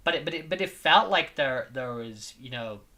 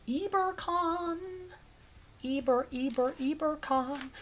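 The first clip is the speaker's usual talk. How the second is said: silly high voice; 12 semitones higher, 4 dB quieter than usual. Pretend. Drawn-out silly name voice.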